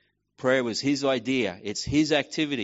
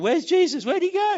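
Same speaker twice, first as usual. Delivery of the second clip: much higher, louder anxious calling; searching disciples; 8 dB louder than usual. Retold or imitated. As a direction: call out looking for someone lost.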